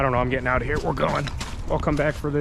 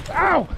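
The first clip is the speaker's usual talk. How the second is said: pained yelp; 13 semitones higher, 4 dB louder than usual. Real, not acted. Hurt while fleeing.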